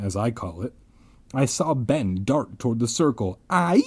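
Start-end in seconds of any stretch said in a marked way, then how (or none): 3.5-3.8 mock wild scream. A narrator's reading. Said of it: "He let out a wild scream".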